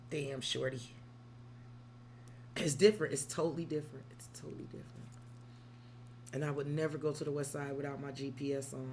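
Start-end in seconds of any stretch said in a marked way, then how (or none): 4.1-4.9 whispered aside. Shown as a demonstration. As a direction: whisper it like a secret. Repeats phrase, second whispered.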